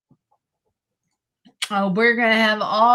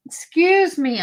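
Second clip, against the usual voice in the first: hiccups; 8 semitones higher, 3 dB louder than usual. Real, not acted. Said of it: "I have the hiccups".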